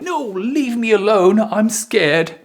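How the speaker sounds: hammed-up frightened protest; acted fear. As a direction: play someone very scared, voice jumping up. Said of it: "when you are very scared".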